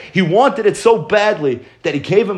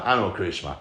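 put-on much lower and softer, a shamed boy; sheepish quiet answer; 11 semitones lower, 9 dB quieter, much faster than usual. The ignorant boy answering the rabbi, low and sheepish. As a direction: mumble it low, embarrassed.

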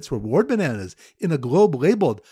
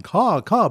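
higher, louder caw caw cry; mock bird call; 5 semitones higher, 5 dB louder than usual. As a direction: call like a crow, a little hammed-up. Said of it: "The bird caller is weeping and going".

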